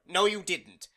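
loud irritated snap; snapping. Acted. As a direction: bite back sharply, high and loud. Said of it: "he snapped back".

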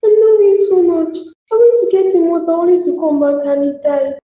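high, tearful grieving voice; widow's tearful lament; crying. Acted. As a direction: cry while pouring out the loss. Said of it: "You have to stop crying".

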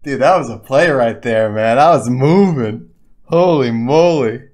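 loud, high, words stretched out in awe; thrilled exclaiming. Real, not acted. Streamer marvelling at his own play, thrilled exclaiming. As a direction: marvel loudly at what you just did. Still thrilled after the win.

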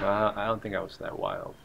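usual speaking voice

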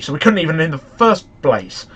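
ordinary talk, nothing marked

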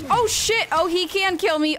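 high, loud alarmed cry; real sudden alarm; startled alarmed yelp; alarmed shout. Real, not acted. Realises creature can kill.

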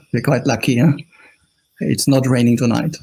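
ordinary delivery

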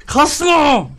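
high, loud shout at the game; sudden shout. Real, not acted. Gamer yelling at the lagging game in a high loud shout. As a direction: yell it out sharply.

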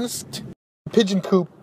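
ordinary delivery

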